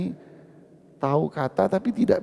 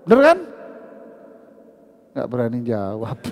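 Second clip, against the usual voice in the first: ordinary talk, nothing marked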